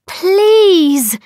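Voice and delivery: high, loud desperate plea; begging. A narrator's reading. Astrid begs the east wind, high and loud. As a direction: beg with everything she has. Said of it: "Astrid begged".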